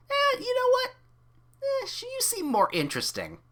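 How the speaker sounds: high, lightly voiced punk girl; a hint of a teasing punk girl; lightly voiced character. A narrator's reading. Reads a character voice.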